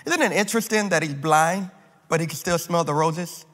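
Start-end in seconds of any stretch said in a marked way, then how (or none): none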